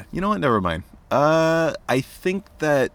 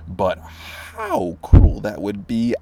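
drawn-out, slow and weighty; slow dramatic line; 4 dB louder, much slower than usual. Acted. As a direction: linger on the cruelty. Poem line slowed.

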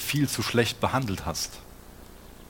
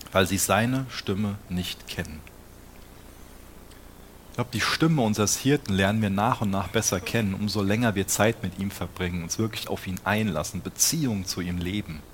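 normal talking voice

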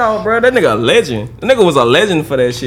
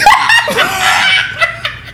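shrieking burst of laughter. Real, not acted. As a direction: howl with laughter. Reacts to dog beheading.

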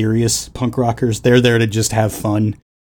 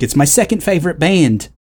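lightly mimicked Aussie chorus, higher; a hint of Australian punk singer, pitched up; Australian accent; 6 semitones higher than usual. Quoting the band's tribute chorus, light Australian accent. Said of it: "even the chorus is like".

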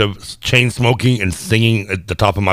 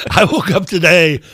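laughing; 9 semitones higher than usual. Real, not acted. Laughing while telling story.

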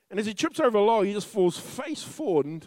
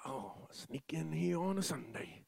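sneaky hushed aside; 5 semitones lower, 16 dB quieter than usual. Pretend. Voicing sneaky churchgoer.

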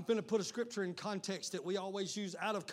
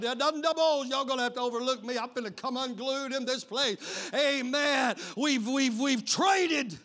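loud, raised pulpit cry; preacher coming unglued; worked-up preaching; 5 semitones higher, 7 dB louder, slower than usual. Real, not acted. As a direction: break into impassioned preaching. Preaching at full force.

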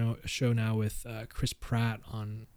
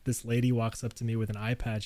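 normal talking voice

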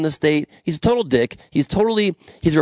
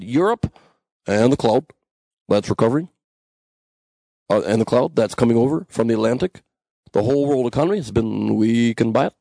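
lightly voiced Obama with long pauses; Obama impression; 4 semitones lower than usual. Host practicing his Obama for listeners, halting impression. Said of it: "I'm working on the Obama impression".

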